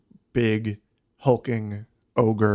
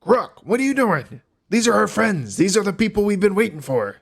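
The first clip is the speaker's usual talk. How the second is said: higher, louder innkeeper telling off his bouncer; a startled barkeep vouching for his guests; Dirk scolding; 10 semitones higher, 5 dB louder than usual. Acted. Innkeeper scolding the orc at the door, higher and louder. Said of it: "he goes".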